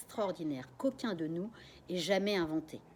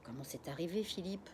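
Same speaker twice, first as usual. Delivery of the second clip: murmur; 5 dB quieter than usual. Acted. Mother asking father softly what happened, murmur. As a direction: ask it under the breath. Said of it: "Elle murmure".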